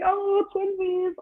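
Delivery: slow, high and cutesy "Oh, twinsies"; mock cute. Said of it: "be like".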